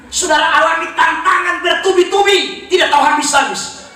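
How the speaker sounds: high, forceful preacher's shout; full-force preaching. Real, not acted. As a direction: drive it home to the congregation.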